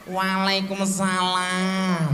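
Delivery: slow, drawn-out coquettish answer; playful, slow wifely reply; coy reply. Shown as a demonstration. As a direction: stretch it out sweetly to tease him. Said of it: "Supaya ibunya endel juga".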